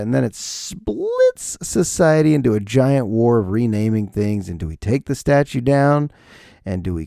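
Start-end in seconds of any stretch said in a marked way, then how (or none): none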